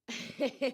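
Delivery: brief high laugh; short laugh. Real, not acted. Coach laughing at losing count, light laugh.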